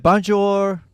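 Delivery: loud, high mock French greeting; hammed-up French accent. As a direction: sing out bonjour like a cartoon Frenchman. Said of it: "very thick French accent".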